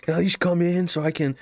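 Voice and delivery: high-pitched, imitated plea; pleading customer. Customer asking to come in from the heat, imitated pleading.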